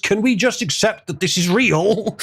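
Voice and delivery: high, exasperated "holy shit"; a pleading plea to just accept it; exasperated outburst. Retold or imitated. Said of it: "sitting here in the middle thinking".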